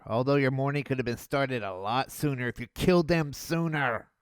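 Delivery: ordinary delivery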